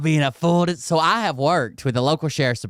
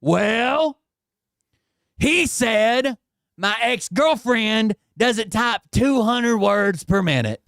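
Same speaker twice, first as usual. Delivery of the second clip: higher, slow pleading mock voice; whiny mock defendant; 8 semitones higher, slower than usual. Streamer voicing Bert's lame excuse to the judge, slow and whiny. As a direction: play him as a feeble excuse-maker.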